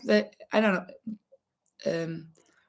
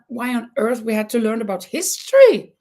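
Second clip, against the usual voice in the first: high-pitched mock indignation; exasperated, high rhetorical questioning; baffled complaint; 9 semitones higher than usual. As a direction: whine like a puzzled young student. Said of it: "I didn't understand".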